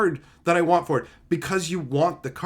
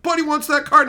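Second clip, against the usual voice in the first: higher, louder eager announcement; mock excited messenger; 10 semitones higher, 6 dB louder than usual. Someone at events announcing a buyer, higher and louder. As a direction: play the eager go-between. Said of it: "somebody would be like".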